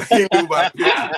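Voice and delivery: high, loud words through a real laugh; cracking up while telling it. Real, not acted. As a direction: laugh it out, tickled by the memory. Laughing at uncle's story.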